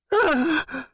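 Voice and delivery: sharp high gasp of shock; pained gasp. Acted. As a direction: gasp as the nail goes in. Nail driven through his hand.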